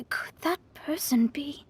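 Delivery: a hushed, voiceless whisper; whispered to herself. Acted. Mona wondering who watches them, whispered. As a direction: breathe the question out in a whisper. Mona's suspicion dawns on her.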